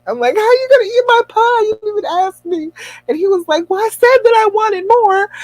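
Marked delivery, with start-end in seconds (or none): 0.4-2.7 high-pitched, wounded mock indignation; hurt protest. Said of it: "I'm hurt". 3.7-5.3 very high, plaintive husband imitation; mock whine. Retold husband whining in pie dispute.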